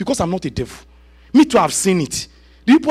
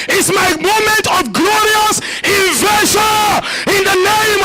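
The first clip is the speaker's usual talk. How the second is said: fervent shouted prayer; 7 semitones higher, slower than usual. Acted. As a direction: storm heaven with every line.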